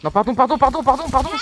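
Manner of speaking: high, loud string of pardons; frantic apology. Real, not acted. Gamer apologising to teammate after killing her, high and loud. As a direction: blurt the sorries in a rush, high.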